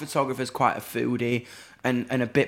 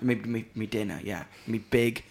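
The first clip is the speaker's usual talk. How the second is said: caveman voice; 3 semitones lower, 6 dB quieter than usual. Pretend. Caveman voice for his wants.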